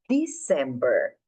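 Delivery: slow model; stress on first syllable. Shown as a demonstration. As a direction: hit the first syllable of December. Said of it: "a sílaba tônica aqui na primeira".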